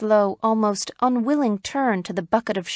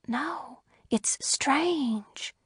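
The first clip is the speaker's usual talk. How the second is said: unvoiced, breathy hush; breathed; 6 semitones higher, 7 dB quieter, much slower than usual. A narrator's reading. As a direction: barely breathe the words. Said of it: "breathed Missus Peters".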